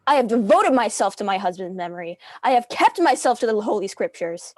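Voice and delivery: loud and indignant, holding her ground. Acted. Widow defending her honor before the court, loud and indignant. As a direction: protest loudly against the accusation.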